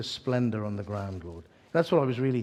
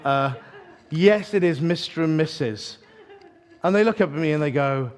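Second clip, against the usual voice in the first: usual speaking voice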